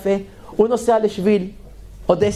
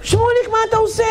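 alarmed rebuke; 11 semitones higher than usual. Retold or imitated.

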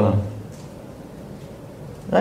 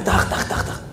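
unvoiced clapping sounds; mimicked hand claps; imitated clapping; 4 dB quieter, slower than usual. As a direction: click out the applause. Imitating applause in story.

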